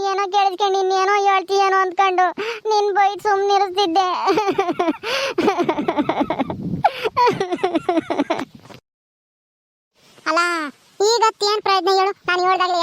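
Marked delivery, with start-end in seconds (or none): 4.1-8.4 drawn-out helpless laughter; rolling laugh that fades away; long laugh. Acted. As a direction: laugh on and on at the deaf granny. Laughing at the granny.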